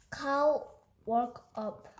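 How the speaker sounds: ordinary delivery, nothing marked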